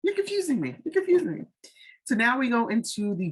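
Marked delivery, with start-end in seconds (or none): 0.0-0.8 high-pitched "you're confusing me"; high exasperated confusion. Retold or imitated. Telling Kyra she's confusing, high and exasperated. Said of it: "I'm like". 0.8-1.4 high-pitched "you're confusing me"; high exasperated confusion. Retold or imitated. Telling Kyra she's confusing, high and exasperated. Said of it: "I'm like".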